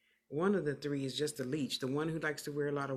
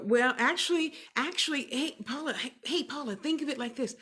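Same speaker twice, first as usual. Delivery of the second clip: excited call; 9 semitones higher, 5 dB louder than usual. Real, not acted. Eagerly calling Paula's attention.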